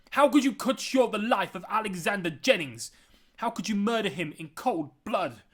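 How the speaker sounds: high, loud, unwavering outrage; angry accusation. A narrator's reading. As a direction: shout the charge at the culprit. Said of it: "which showed how angry he was".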